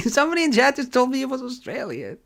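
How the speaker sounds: high, squeaky laughing protest. Real, not acted. Streamer blaming chat for the Australian mix-up, laughing. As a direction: protest through helpless laughter, pitch way up. Cracking up at chat's wrong correction.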